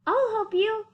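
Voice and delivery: a keen elephant's helpful cry; Horton calling out. Acted. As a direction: offer help to the tiny voice. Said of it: "said Horton".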